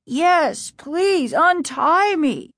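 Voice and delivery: high, begging urgency; desperate pleading. A narrator's reading. Bound father begging his kids, desperate pleading. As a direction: beg to be released, stretching the last words.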